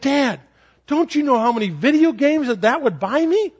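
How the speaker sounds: put-on a seven-year-old bargaining with his dad, lightly childlike; child's voice. A hint of an excited kid.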